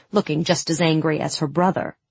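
ordinary delivery

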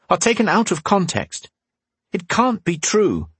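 a hint of heated protest; young man arguing. A narrator's reading.

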